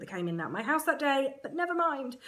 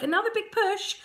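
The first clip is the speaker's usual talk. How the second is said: midwife voice; 10 semitones higher than usual. Retold or imitated. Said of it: "she's like".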